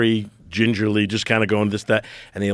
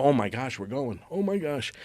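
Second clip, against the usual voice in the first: softer, breathy-free dread under the breath; hushed freaking out; 2 semitones higher, 9 dB quieter than usual. Retold or imitated. Waiting backstage as the CEO introduces him, quiet dread. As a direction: mutter the dread quietly to himself. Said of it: "So I start freaking out".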